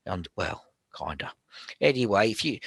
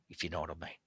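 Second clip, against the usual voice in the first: whispered aside; 6 semitones lower, 13 dB quieter, much faster than usual. Real, not acted. Healer whispers a knowing aside to his students. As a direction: drop to a breathy whisper for the aside.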